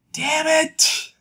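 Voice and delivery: high-pitched, exasperated outburst; mock frustrated curse. Jazz told she can't take it back, high mock frustration. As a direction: curse as if caught out, playfully hammed up. Jazz caught by her own forgiveness.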